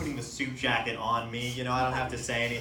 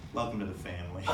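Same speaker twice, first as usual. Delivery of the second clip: ominous mafia welcome; 8 semitones lower, 8 dB quieter, faster than usual. Retold or imitated. Mafia barber, low, ominous.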